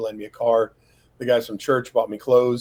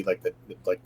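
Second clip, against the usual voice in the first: ordinary talk, nothing marked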